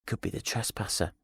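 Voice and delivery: softly voiced inner thought; frightened thought. A narrator's reading. Scared woman wondering who is coming, hushed thought. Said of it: "she thought".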